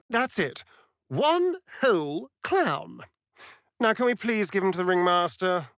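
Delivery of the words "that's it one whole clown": ordinary talk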